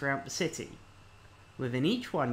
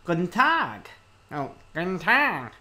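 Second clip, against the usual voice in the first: higher, louder sing-song greeting, nasal and chirpy; sing-song nasal woman; 4 semitones higher, 6 dB louder than usual. Acted. Reading a game character, sing-song nasal woman. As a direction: chirp it like a nosy receptionist. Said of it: "nasal woman's voice can be heard again in a sing-song tone".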